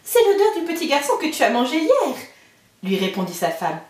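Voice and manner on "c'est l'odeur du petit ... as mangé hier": higher, half-laughing warning voice; the giant's wife hurrying the boy away; ogre's wife warning. Acted. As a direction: shoo the child away with a nervous chuckle. Wife covers for Jacques.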